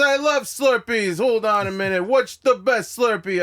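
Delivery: high, drawn-out excited holler; hammed-up shouting enthusiasm, slow on the question; Andrew Dice Clay. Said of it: "He goes".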